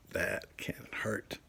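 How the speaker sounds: hushed whispered warning; whispered warning to kids about dropping weights on their toes. Acted. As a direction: whisper it like a secret caution.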